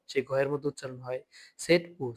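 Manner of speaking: ordinary talk, nothing marked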